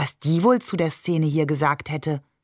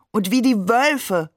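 higher, drawn-out exclamation; playful raised-pitch call; 4 semitones higher, 3 dB louder than usual. A narrator's reading. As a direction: chime in with a slow call. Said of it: "rief Ali".

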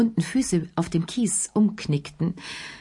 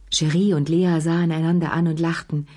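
usual speaking voice

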